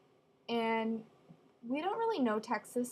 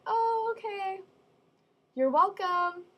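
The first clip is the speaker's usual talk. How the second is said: higher, mock sweet sarcasm; sarcastic reply; 9 semitones higher, 4 dB louder, slower than usual. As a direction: act huffy-polite about it. Retorting after his thanks.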